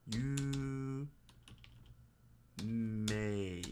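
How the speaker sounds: You then may said apart; syllable by syllable; split syllables. Shown as a demonstration. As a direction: pause between you and may. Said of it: "you gotta stretch that E part".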